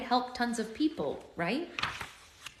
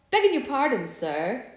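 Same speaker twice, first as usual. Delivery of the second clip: a loud, lightly put-on German accent; 5 semitones higher, 6 dB louder than usual. Vendor apologizing to Sam, in a German accent. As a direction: beg pardon in a German accent. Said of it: "she said in a thick German accent".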